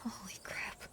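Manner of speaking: breathless whisper of awe; awed whisper. Real, not acted. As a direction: hush it like you've seen a ghost. Player reacts in awe.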